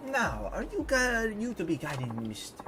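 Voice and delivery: high, grand French diva voice; imperious mock-French lilt; questionable French accent. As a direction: play a haughty lady with a hammy French accent. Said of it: "do my questionable French accent".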